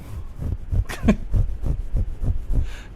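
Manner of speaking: high loud raspy cackle; creature laugh. Pretend. Joking about a Stitch voice, loud high cackle. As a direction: cackle like a little monster. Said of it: "hybrid Stitch slave voice".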